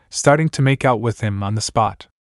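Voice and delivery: usual speaking voice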